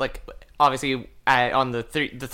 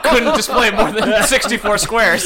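laughing; 8 semitones higher, 7 dB louder, slower than usual. Real, not acted. Joking, laughing through words.

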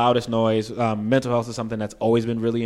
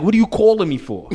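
put-on New York accent, higher and louder; strong New York accent; 8 semitones higher, 5 dB louder than usual. Friend on FaceTime from California, imitated strong New York accent. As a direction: lean into the New York vowels. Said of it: "I didn't realize how strong our accents are".

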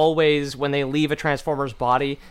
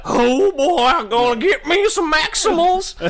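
high, loud Texas cowboy voice; Texas accent; 12 semitones higher, 4 dB louder than usual. Retold or imitated. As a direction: ham up a Texas cowboy toy. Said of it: "He talks, he talks like a Texan".

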